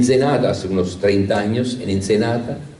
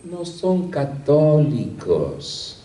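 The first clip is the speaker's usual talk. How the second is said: slow drawn-out answer; 4 semitones higher, much slower than usual. Retold or imitated.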